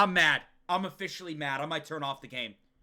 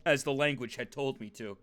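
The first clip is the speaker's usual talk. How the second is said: lower, measured reading voice; story narration; 4 semitones lower, 3 dB quieter than usual. Acted. Streamer reading the character's bedtime thoughts, subdued narration. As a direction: read it like an inner monologue, subdued. Reads game narration aloud.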